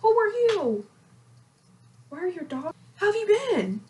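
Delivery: squeaky who-are-you; high confused self. Retold or imitated. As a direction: play your past self, baffled and high. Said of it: "I'm like".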